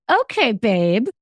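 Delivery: tight, sugary okay babe; biting back anger with a forced sweet okay; forced calm. Retold or imitated. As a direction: swallow the anger, sound sweet. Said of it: "she bites her tongue and goes".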